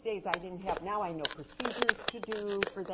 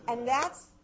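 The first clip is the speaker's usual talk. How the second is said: ordinary talk, nothing marked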